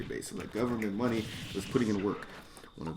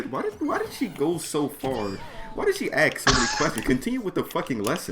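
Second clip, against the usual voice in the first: exasperated rant; 6 semitones higher than usual. Retold or imitated. Fed up with the teacher's repeated questions, exasperated. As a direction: vent at the teacher, quickening.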